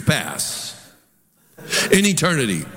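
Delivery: ordinary talk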